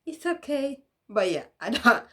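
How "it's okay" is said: high, lightly voiced child; a hint of a kid calming mom down; kid soothing mom. Child reassuring mom, lightly raised voice. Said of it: "I'd be like".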